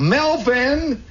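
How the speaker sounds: put-on high-pitched mother calling her boy; put-on high lady's call. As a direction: holler for a kid in another room. Neighbor calls her kid.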